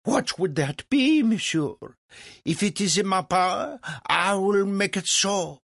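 high, pompous French voice; eager French impresario. Acted. Legras offers to grant Franklin's wish, eager and grand. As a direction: offer your power grandly.

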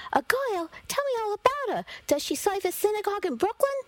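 put-on mother thrilled by news of a girl; excited. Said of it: "My heart sped up".

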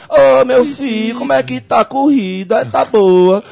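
high, slow sing-song Baiano caricature; Bahian accent. Retold or imitated. Host imagining a Bahian station's reporter, drawling Baiano accent. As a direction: stretch it out like a laid-back Bahian. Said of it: "põe uma emissora do, dos baianos".

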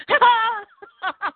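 high mock ha ha; fake triumphant laugh. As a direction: laugh it off to cover a fall. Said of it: "I got up and I went".

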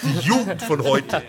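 put-on high, loud grumbling old man; grumpy old man. Parodies an old man's complaint.

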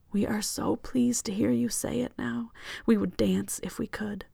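unvoiced ghostly whisper of the cloud; eerie collective whisper. A narrator's reading. The Reaper cloud whispering to the passenger, eerie whisper. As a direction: breathe the words like a hive mind.

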